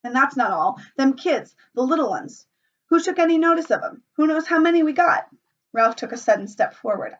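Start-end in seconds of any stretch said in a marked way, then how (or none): 0.0-5.3 indignant high scolding. A narrator's reading. Piggy scolds the boys.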